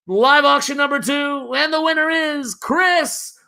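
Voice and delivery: high, drawn-out announcer call; big, slow reveal of the winner; winner announcement. Real, not acted. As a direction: stretch it out like a ring announcer. Auctioneer announces the winning bidder.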